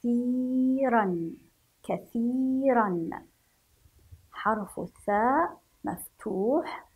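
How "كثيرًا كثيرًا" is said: long drawn-out ي in كثيرًا; مد الياء. Shown as a demonstration. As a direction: stretch the ي of كثيرًا slowly. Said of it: "وهناك مد الياء". (مدّ الياء في كثيرًا ببطء)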